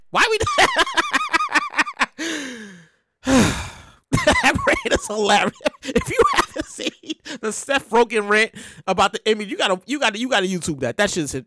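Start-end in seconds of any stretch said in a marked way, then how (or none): 0.4-2.5 long, high laughing fit. Real, not acted. Host remembering Seth Rogen's rant, high laughter. 4.1-6.9 high, words broken by laughter; laughing while talking. Real, not acted. Host recommending a hilarious rant, cracking up.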